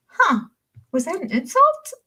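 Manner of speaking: high, puzzled "huh, was that an insult"; puzzled surprise. Retold or imitated. Replaying her first misreading, high and puzzled. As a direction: pretend to be confused and offended. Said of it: "'Cause I was like".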